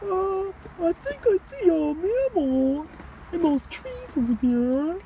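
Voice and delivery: high, slow, drawn-out silly voice; hushed-up falsetto nature commentary; put-on wildlife spotter. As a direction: point out a creature in mock wonder. Mock sighting of a creature.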